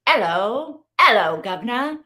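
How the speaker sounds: light Cockney "Hello, governor" with rising end; Cockney accent, lightly done; mock Cockney. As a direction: play the Mary Poppins chimney sweep in Cockney. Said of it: "That's how I'm reading it".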